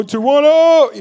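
high, loud, drawn-out hype call; hype influencer shout. Pretend. Parodying a fake influencer video, hyped shout. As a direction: belt the area code like a showoff vlogger.

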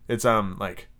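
ordinary delivery

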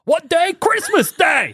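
very high, hammed-up Dickensian voices; Christmas Carol quote. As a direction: squeal it like a Victorian urchin. Scrooge quote, high acted.

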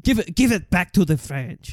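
put-on comic French accent. Urging a French remake, in a mock French accent. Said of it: "asking you to do the accent".

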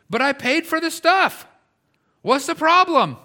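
high, loud mock bewilderment; acted indignant protest. Preacher as the man who paid for the stuff, indignant. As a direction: protest loudly, pitched right up.

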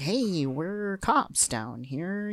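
very slow, drawn-out mock American dub; goofy American accent. Podcaster mocking the American dub, goofy drawling American accent. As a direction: stretch it out in a silly American accent. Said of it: "it's so goofy sounding".

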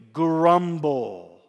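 long, rumbling "grumble"; onomatopoeic grumble. Shown as a demonstration. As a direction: GRUMBLE drawn out, slightly low. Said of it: "it sounds like what it is".

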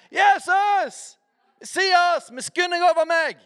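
high-pitched pleading shout; Bartolomeus crying out. Retold or imitated. Blind man shouting to stop Jesus on the road, high desperate cry. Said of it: "Husker dere han som ropte".

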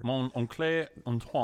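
film title in a French accent. Real, not acted. Co-host repeating the foreign film title, French accent. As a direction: say it with a light, drawn-out French accent. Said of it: "you say that with a French accent".

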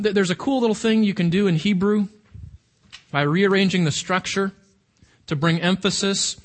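ordinary talk, nothing marked

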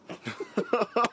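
a burst of high, rising laughter. Real, not acted. Laughing as he tells the interviewer about staggering to bed. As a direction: laugh at how absurd it was. Laughing at own near-collapse.

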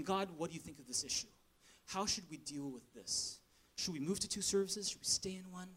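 hushed, intimate prayer to God; quiet prayer. Retold or imitated. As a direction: murmur it like a private prayer. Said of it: "It's me just going".

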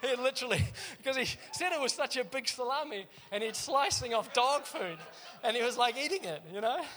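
real laughter retelling the dog food story; laughing. Real, not acted. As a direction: tell it while cracking up.

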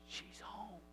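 put-on very soft, hushed child's reply; whispering niece. Niece answering her uncle on the phone, whispering. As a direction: keep it down to a whisper. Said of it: "she whispered".

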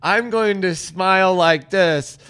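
slow, loud, hammed-up composure; forced brave front. Podcaster voicing contestants shattered on the inside, loud slow forced cheer. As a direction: push each word out through a fixed smile. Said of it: "You can see it in their eyes".

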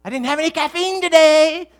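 high, loud complaining yell, acted out; body yelling. Body yelling at its owner over missed caffeine, mock outraged yell. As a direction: holler like a demanding body throwing a mock fit. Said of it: "your body's kind of yelling at you".

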